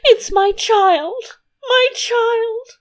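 very high, drawn-out wail; hammed-up tearful queen, slow and high; acted sobbing. A narrator's reading. Queen laments her child to the king, acted sobbing. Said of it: "sobbed her majesty".

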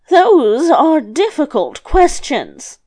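put-on high, slow, careful old grandmother; lightly voiced grandmother. Grandmother weighing the youth's hard questions, lightly voiced.

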